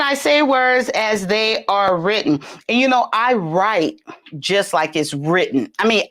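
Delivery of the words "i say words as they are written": ordinary talk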